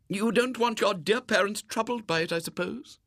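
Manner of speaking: high, sympathetic lady's voice; touched lady. A narrator's reading. Lady touched by the boy's appeal, sympathetic. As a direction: ask indulgently, pitched well up.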